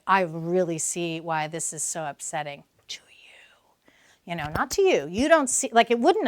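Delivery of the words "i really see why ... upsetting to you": slow unvoiced whisper. Shown as a demonstration. Parent validating an upset teen, whispered. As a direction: breathe it softly and slowly. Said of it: "you have to say".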